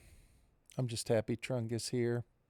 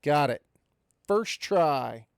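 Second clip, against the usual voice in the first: excited; 2 semitones higher, 6 dB louder, slower than usual. Real, not acted. Found it after struggling.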